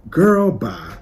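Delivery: loud, sassy brush-off; dismissive. Acted. Fan dismissing [name]'s post, loud sassy brush-off. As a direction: wave her off with sass.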